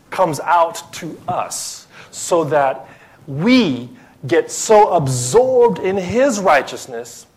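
slow, high, weighty preaching; impassioned preaching. Real, not acted. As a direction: preach it slowly, stretching the key words. Preacher building to his point.

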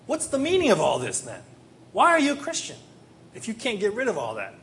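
high, loud, indignant questioning; exasperated challenge. Acted. God questioning a bitter believer's faith, exasperated.

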